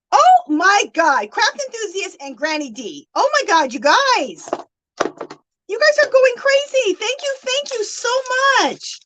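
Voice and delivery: high, thrilled squeal of gratitude; overwhelmed excitement. Real, not acted. Streamer overwhelmed by viewers' donations, excited. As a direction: gush at a very high pitch.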